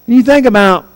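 ordinary talk

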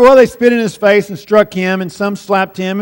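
brisk, skimming read with no weight on the words; quick read-over. Shown as a demonstration. Preacher showing how easy it is to read over the text, quick read-over. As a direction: rattle it off as if skimming past it. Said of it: "easy to read over that pretty quickly".

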